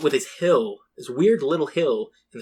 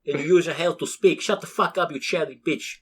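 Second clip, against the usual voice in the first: yelling Russian; 5 semitones higher, 3 dB louder than usual. Retold or imitated. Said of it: "the Russian one yelling at him".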